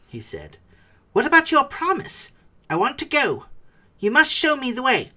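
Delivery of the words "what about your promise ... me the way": high, loud insistent demand; Bilbo demanding. A narrator's reading. Bilbo pressing Gollum to keep his promise, insistent.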